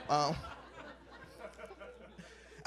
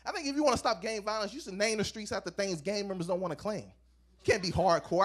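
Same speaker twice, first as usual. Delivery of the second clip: ordinary delivery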